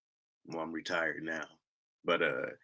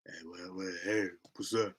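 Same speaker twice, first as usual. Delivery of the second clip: very soft, low mumbled greeting; mock a caller with uncleared throat; groggy voice; 4 semitones lower, 13 dB quieter than usual. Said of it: "fix your shit".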